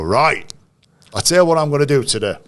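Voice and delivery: high, loud, fast imitation of a player's rallying call; rousing declaration. Player standing up before the game, imitated as a loud rousing announcement. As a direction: burst out as if rallying the changing room. Retold rousing dressing-room speech.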